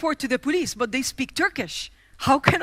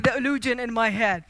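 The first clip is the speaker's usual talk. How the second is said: ordinary talk, nothing marked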